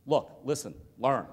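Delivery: normal talking voice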